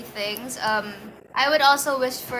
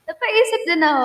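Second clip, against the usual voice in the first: ordinary delivery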